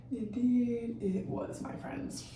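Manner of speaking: whispered, ominous aside to viewers; hushed dramatic whisper to the audience. Acted. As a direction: breathe it like a spooky secret.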